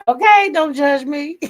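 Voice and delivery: high, loud laughing don't judge me; laughing plea. Real, not acted. Laughing at her crispy food habit, asking chat not to judge. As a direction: giggle it out, begging not to be judged.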